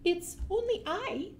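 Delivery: small high squeak of a troll. A narrator's reading. Scared troll answering the fish in a high squeak. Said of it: "the troll squeaked".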